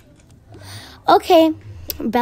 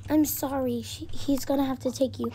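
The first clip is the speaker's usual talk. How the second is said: quiet apology; 10 dB quieter than usual. Acted. Teacher gives girl bad news.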